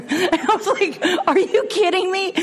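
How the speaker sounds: high, laughing are you kidding me; laughing disbelief. Retold or imitated. Telling the group how she laughed at the thought. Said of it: "I laughed out loud".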